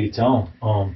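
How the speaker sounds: ordinary talk, nothing marked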